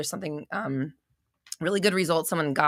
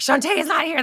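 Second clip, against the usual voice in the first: "Shantae is not here" lifted high and louder, stress on "not"; higher, louder replay; 11 semitones higher, 6 dB louder than usual. Shown as a demonstration. Host replaying to a friend how she blurted it out, higher. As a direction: replay the line in a high, pointed voice. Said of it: "That's why I said it like that".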